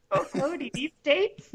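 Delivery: high, laughing girl imitation. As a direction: squeak it like a girl.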